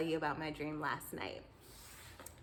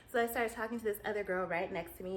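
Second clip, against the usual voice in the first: ordinary talk, nothing marked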